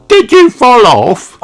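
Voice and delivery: high, loud eager pup voice; eager little son asking; Danny asks. A narrator's reading. Danny asking his returned daddy, high eager voice. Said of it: "asks Danny".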